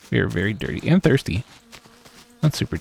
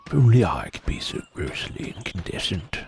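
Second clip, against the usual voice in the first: softer, lightly voiced knightly hero; a hint of the sun-loving knight, dreamy; mild Solaire voice, softly wistful; Solaire impression; 2 semitones lower, 5 dB quieter than usual. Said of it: "giving Solaire".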